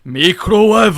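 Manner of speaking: microwave read as mikurowave, high and loud; mock Japanese accent. Shown as a demonstration. Friend jokes that Japanese reads English phonetically, mock Japanese accent. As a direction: belt out microwave the Japanese katakana way. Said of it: "you just read it phonetically".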